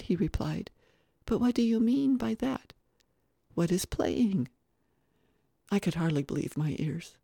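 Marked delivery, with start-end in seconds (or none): 1.3-4.5 put-on higher childlike questioning; puzzled little boy. Little boy who never learned to play, puzzled. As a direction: ask in innocent puzzlement.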